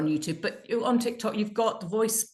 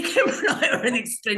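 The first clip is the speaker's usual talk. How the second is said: laughing; 5 semitones higher, 5 dB louder than usual. Real, not acted. Laughing at their own extreme video.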